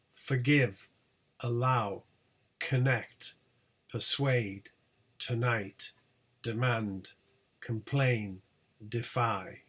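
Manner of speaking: "forgive, allow, connect" each weak first syllable, stressed second; word list like "persuade, tonight, defy", pause after each, punch the second syllable. Shown as a demonstration. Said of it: "primary stress on the second syllable".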